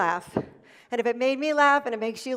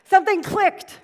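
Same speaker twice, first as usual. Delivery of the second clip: excited punch; 8 semitones higher, 8 dB louder than usual. Real, not acted. Punchline after laugh.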